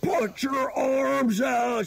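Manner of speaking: very slow, high-pitched yell; panicked mock shout. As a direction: shout it in hammed-up slow motion. Father yells to falling baby.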